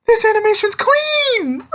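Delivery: very high-pitched, excited squeal; squealing fanboy. Retold or imitated. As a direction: shriek it like a thrilled fan. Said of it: "and I'm like".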